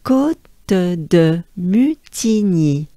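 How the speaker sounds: Côte de Mutigny said slowly, kot-de-mü-ti-nyee; slow French pronunciation. Shown as a demonstration. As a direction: demonstrate the whole name slowly. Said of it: "we take a good breath".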